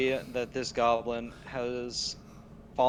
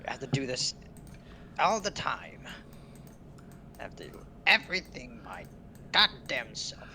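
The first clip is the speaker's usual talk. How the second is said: goblin voice; 3 semitones higher than usual. Acted. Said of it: "Goblin voices".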